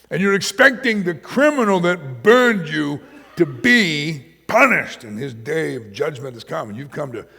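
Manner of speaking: loud, high, driving sermon voice; fervent preaching. Acted. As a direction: press the point home. Preaching at full force.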